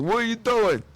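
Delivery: high, loud mock shout; hammed-up angry shout; mock yell. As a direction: bark it at the Bulgarian guys. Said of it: "He's like".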